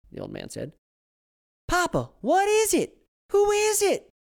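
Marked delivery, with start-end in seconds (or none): 1.7-4.1 put-on high, loud old Southern woman calling out. Old woman yells at husband from the kitchen, high and loud. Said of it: "old woman asking husband".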